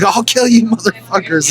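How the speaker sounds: high-pitched mock-angry threat; retold threat. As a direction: act out a kid threatening the cops. Handcuffed teen threatening the cops.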